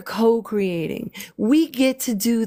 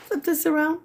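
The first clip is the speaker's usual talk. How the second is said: playful high voice; 9 semitones higher, faster than usual. Pretend. Playful while flipping the drawing.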